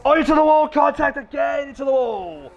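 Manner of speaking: high, loud shout at a crash; shouting race caller. Real, not acted. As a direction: yell the crash call. Crash during live commentary.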